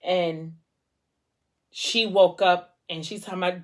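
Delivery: normal talking voice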